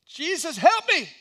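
high, loud cry for help; desperate scream. Acted. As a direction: scream as if drowning. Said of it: "And he screams".

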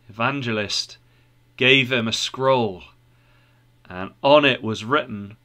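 plain reading voice

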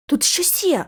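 plain reading voice

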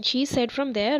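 ordinary talk, nothing marked

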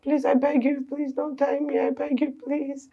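high, urgent begging; desperate pleading. Acted. Captive begging kidnapper not to tie her, desperate. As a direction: plead frantically, promising anything.

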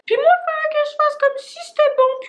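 beaucoup plus aigu, voix de petite fille; voix aiguë d'enfant. Acted. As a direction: joue la fillette coincée, très aigu. Enfant forcée de manger litière. (voix d'enfant très aiguë qui fait semblant)

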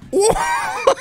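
very high startled yelp; real jump scare scream; startled shriek. Real, not acted. Creature charges.

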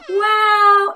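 high gasp then loud wow; big surprised gasp. Acted. Teacher showing children amazement at the open chest. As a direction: act amazed for the class.

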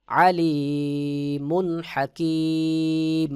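slow melodic tilawat, long held notes; Quran recitation. Shown as a demonstration. Teacher recites the verse melodically for students. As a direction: chant it slowly, sustaining the vowels.

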